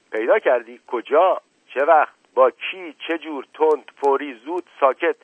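high, loud volley of short questions; rapid-fire interrogation. A narrator's reading. Officer grilling the servant about the find, loud interrogation. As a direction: hammer out each question sharply.

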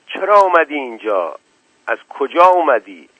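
loud, high challenge; yelling. A narrator's reading. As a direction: yell questions at an intruder. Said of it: "زاهد هوار کشید". (هوار کشیدن با صدای بلند)

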